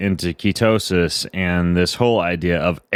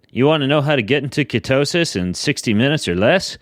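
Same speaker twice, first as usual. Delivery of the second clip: high-pitched salesman's hook; used car salesman; 7 semitones higher than usual. Pretend. Podcast host opens by hooking a kid on ketosis, used car salesman voice. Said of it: "Do I sound like a used car salesman?".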